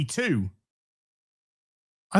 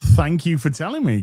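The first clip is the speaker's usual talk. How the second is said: ordinary talk, nothing marked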